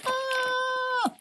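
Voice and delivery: small high-pitched scream; mock scream, kept soft; thin, high squeal of fright; imitated scream. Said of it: "He's like".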